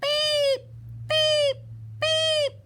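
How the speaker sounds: high, loud, even beeps; urgent horn beeps. A narrator's reading. Stuck little truck calling friends, urgent high beeps. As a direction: honk for rescue in high bursts. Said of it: "cried the little blue truck".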